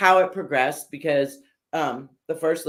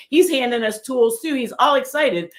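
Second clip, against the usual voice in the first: higher, louder, worked-up argument; retold plea, pitched up and pressing; insistent pleading; 9 semitones higher, 4 dB louder than usual. Builder arguing to host for her stepson, insistent.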